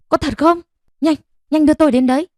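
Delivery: high, fast, rushed disbelief; urgent excitement. Acted. Bà Thi begging to be taken to her husband, high fast urgency. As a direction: blurt it out in a high rush.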